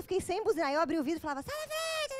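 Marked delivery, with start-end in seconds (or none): 1.4-2.1 very high, honking yell; voice as horn. Retold or imitated. Driver with no horn yelling out the window, high honking cry. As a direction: imitate a horn with your own voice. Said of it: "a voz continua".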